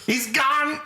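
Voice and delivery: very high panicked cry; Loomis. Retold or imitated. Host reprising the doctor's line to mock the actor, high frantic impression. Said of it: "he seems so gone".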